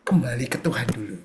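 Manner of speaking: low, soft voice of God; a calm low divine voice. Acted. God calling a distant believer back, low and soft. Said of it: "suara Tuhan akan cuma ngomong itu".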